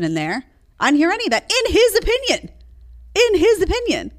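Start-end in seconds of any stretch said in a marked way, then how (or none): 1.5-4.1 "his" punched high and loud, twice; insisting it's only his view; stress on his. Real, not acted. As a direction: hammer home "In his opinion".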